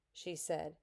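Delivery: ordinary delivery, nothing marked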